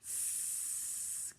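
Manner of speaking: long soft snake hiss. Shown as a demonstration. Teacher showing children how a snake hisses. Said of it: "The snake goes".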